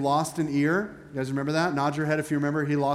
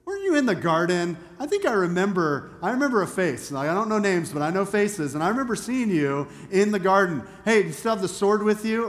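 higher, louder voice of sudden recognition; excited bystander chiming in; 5 semitones higher, 4 dB louder than usual. Acted. Servant's relative accuses Peter of being in the garden, excited chiming in. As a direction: pipe up with halting, dawning recognition. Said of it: "all of a sudden he chimes in".

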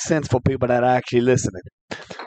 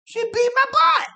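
high-pitched squeal of remembered pain. Acted. Telling cohost how mama punished him, squealing high. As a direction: squeak it out like the beating still stings. Punchline about mama's beating.

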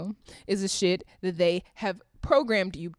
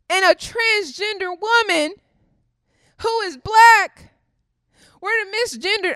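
rising outraged shout; 9 semitones higher, 6 dB louder than usual. Real, not acted. Ranting at the viewers about misgendering, outraged shout.

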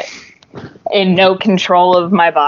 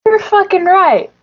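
hammed-up high declaration of agreement; emphatic acted agreement; 11 semitones higher than usual. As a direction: declare it grandly, pitched well up. Acting out emphatic agreement with Darius.

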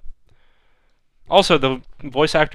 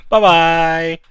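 sing-song goodbye; 6 semitones higher, 8 dB louder than usual. Real, not acted. Sign-off in put-on voice.

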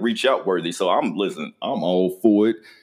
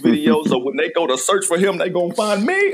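high, gleeful rant building to a peak; hyped-up, climbing higher as it goes; excited anticipation; 6 semitones higher than usual. Retold or imitated. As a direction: crow it with mounting excitement. Retells own gleeful anticipation, rising to loud.

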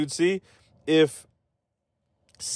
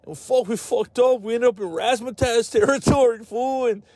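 much higher hammed-up thug voice; mock gangster story; 9 semitones higher than usual. Gang member explaining to brother, high mock thug voice. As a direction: tell it like a cartoon gangster caught out.

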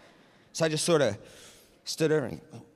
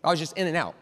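ordinary talk

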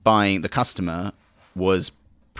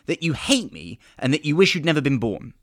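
excited customer; 9 semitones higher than usual. Acted. Customer demands in sketch.

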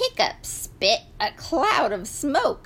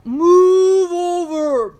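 high, loud, slow moo; bossy cow order; 7 semitones higher, 8 dB louder, slower than usual. A narrator's reading. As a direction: bellow it as one long moo. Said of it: "bossed the cow".

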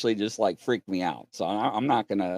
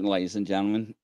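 usual speaking voice

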